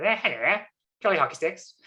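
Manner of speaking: high, mock-posh English twang; posh RP parody. Mock upper-class English accent, mocking her own RP way of speaking. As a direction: send up a jolly hockey sticks toff. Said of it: "I don't actually talk like that".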